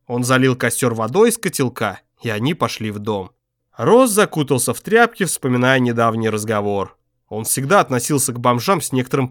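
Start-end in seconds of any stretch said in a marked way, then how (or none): none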